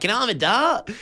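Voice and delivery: put-on high, broad Australian voice; broad Aussie accent. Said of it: "I overheard her just being like".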